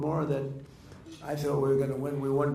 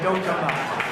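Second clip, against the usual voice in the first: raised, altered voice for the warning; retold warning; 4 semitones higher, 6 dB louder, slower than usual. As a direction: act out warning David off. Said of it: "So I saved your life by saying".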